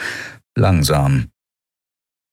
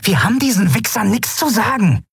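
defiant. A narrator's reading. Amalin defies the captors.

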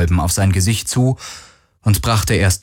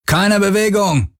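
high-pitched command, a narrator's contained shout; roared order; 10 semitones higher than usual. A narrator's reading. Intruder orders the bridge crew to freeze, contained high shout. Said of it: "brüllte einer von ihnen".

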